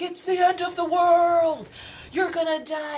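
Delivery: high, loud doom-prophet voice. Retold or imitated. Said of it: "She was like".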